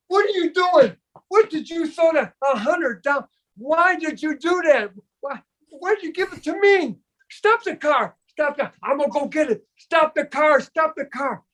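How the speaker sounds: high, hammed-up shrieking panic; frantic outrage. Friend panicking about the hundred in the bottle, shrill mock rage. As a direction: shriek at him to stop the car.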